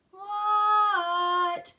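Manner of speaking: long, high, loud shout back; hollered reply. A narrator's reading. Froggy answering his mother's call, long loud holler. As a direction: stretch it out high across the house.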